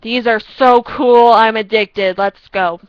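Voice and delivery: loud slow these are so cool; imagined newbie gushing; excited fan. Pretend. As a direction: gush like a hooked fan. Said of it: "you're gonna be like".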